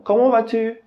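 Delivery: teacher voicing a friend who greets you; asking voice. Shown as a demonstration. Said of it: "Ou então".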